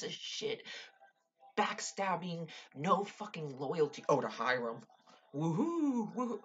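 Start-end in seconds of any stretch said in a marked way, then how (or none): 5.4-6.4 high, loud sarcastic woohoo; hammed-up sarcastic celebration; mock cheer. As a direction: cheer him on mockingly. Cheering at Archie's loyalty to Hiram.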